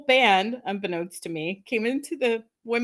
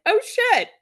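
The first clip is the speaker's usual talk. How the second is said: high, loud acted shock; shocked realisation; 14 semitones higher, 6 dB louder than usual. Fan sees bathroom intruders on stage, shocked. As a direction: replay the jolt of sudden recognition. Retold shock on recognising the band.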